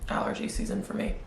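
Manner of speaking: cracked voice fading to breath; voice cracking. Real, not acted. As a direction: voice breaking into near whisper. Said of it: "my voice keeps cracking".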